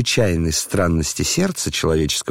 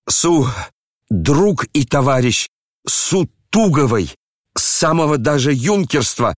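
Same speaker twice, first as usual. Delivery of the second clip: повыше, в азарте; старик горячо кричит; кричал в азарте; 6 semitones higher than usual. A narrator's reading. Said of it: "Генерал кричал в азарте". (в азарте, повыше)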